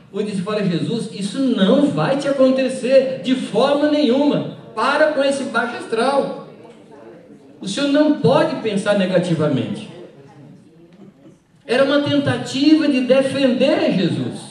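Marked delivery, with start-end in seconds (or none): 0.7-9.9 mock insistent objection, higher pitch; acted protest. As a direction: lightly play an alarmed follower forbidding it. Acts disciple protesting to Jesus.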